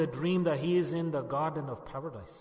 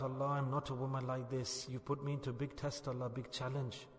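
hushed, low retold prayer; quiet, subdued pleading; inward plea; 3 semitones lower, 8 dB quieter than usual. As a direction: softly voice a silent cry to Allah. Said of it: "she called out to Allah in her heart".

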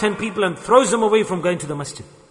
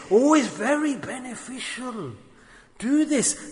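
sugarcoated persuasion; 6 semitones higher than usual. Acted. Shaitan shows you a benefit, in a high coaxing voice. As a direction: coax in a raised, softened voice. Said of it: "he shows you its benefit".

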